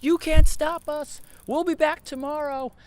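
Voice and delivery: hammed-up protester shout, stretched and high; yelling defiance. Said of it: "they start yelling at the police".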